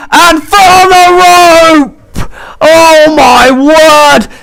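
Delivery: shouted, stretched-out near-fall call; screaming commentator. Real, not acted.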